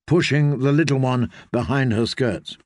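plain reading voice

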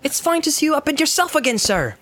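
high, cheerful hobbit, a bit quick; chatty loyal servant, pleased; Sam's voice. Acted. Sam speaks to Frodo.

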